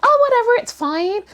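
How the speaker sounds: high, airy mock nonchalance; dismissive brush-off. Brushing off the worry at first, mock breezy. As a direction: wave it away as no big deal. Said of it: "At first I was like".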